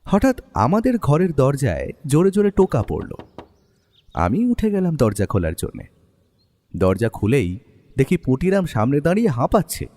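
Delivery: normal talking voice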